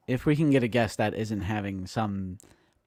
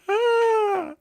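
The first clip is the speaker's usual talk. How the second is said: scream; 5 dB louder than usual. Retold or imitated. SpongeBob meme scream.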